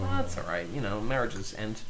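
low, soft, dry consolation; understated, deadpan reassurance; Steve Coogan-like. Real, not acted. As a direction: offer it quietly, like an awkward British comic. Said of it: "You sounded just like Steve Coogan".

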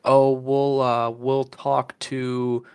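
drawn-out, hesitant mock clerk; slow noncommittal customer-service reply, imitated; retold stalling. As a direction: stretch it out as if stalling.

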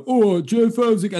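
high, loud mimicry of a London greeting; mocking London-accent impression. Middle-class comic mimicking his accent back at him, mocking London impression. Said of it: "they'll do an impression of me back to me".

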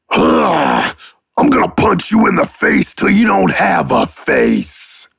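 high put-on voice, dropping at the end; threatening character voice. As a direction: act out a mock threat in a high-pitched character voice. Demoing character voices, threatening someone.

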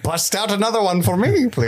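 high, pleading put-on voice; mock party guest. Celebrimbor asking for more coke.

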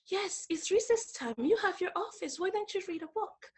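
high, soft, measured mimicry; imitating a soft-spoken, disapproving boss; quiet boss. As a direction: scold politely in a small hushed voice. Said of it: "she said very quietly".

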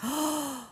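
quick, high intake of breath; mock gasp. Reacting to the monsters' awakening, high soft gasp. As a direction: gasp softly as if amazed. Gasp after the movie line.

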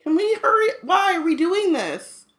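high pleading whine; whiny. Real, not acted. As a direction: beg to leave. Whining to go nap.

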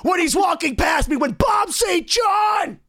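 very high, drawn-out mock shouting; hammed-up imitation of an offended guitarist ranting; indignant rant. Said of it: "a four page message like".

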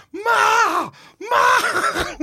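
high, yelled cry of "Ma!"; De Niro yelling Ma. Retold or imitated. Grown man in basement yelling up to his mom, high cry. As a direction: bellow up the stairs like a grown son in the basement. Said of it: "De Niro in The King of Comedy".

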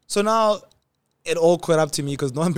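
ordinary talk

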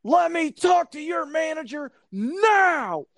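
high, slow shout for the manager; mock shout of an irate trespasser; shouting demand. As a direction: bellow it like an entitled customer. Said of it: "And then I hear it".

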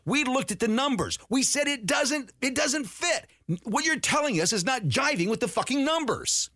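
heated high-pitched protest. Real, not acted. Defending his side against critics, indignant and heated. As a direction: throw the insults back, fired up.

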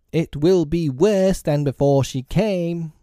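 high, slow threatening cry. A narrator's reading. Panic-stricken lost boys advancing on Wendy, crying out threateningly. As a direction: cry it out high, drawing out the words. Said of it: "they cried".

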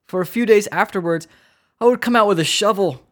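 high, hammed-up witness testimony; distraught squirrel victim. Victim recounting her shovel patrols, hammed-up distraught. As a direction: keep up the distraught victim act, pitched high. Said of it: "I will now be playing the role".

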